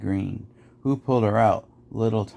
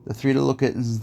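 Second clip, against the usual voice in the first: ordinary delivery, nothing marked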